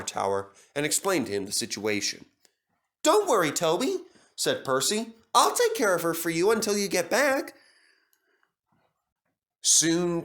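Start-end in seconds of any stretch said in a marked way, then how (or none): 3.0-7.5 higher, friendly engine voice; lightly voiced cheerful little engine; reassuring Percy. A narrator's reading. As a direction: promise to help a friend.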